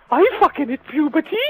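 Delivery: mock his cracked outraged voice; squeaky kid impression. Mocking the teenager's protest, high squeaky voice. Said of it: "And one goes".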